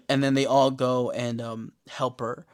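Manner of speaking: normal talking voice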